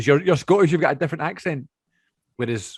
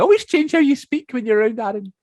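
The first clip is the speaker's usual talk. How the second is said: much higher, louder imitated relative; complaining family member; 12 semitones higher, 5 dB louder than usual. Family member calling out the accent change, high mimicry. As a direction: mimic an accusing relative, pitched up. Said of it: "would always say".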